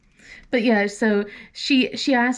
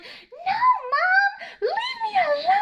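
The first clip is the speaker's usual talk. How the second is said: put-on much higher, laughing whine of a mortified teen; embarrassed daughter; slower than usual. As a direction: squeal it like a teen begging mom to stop. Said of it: "And she's like".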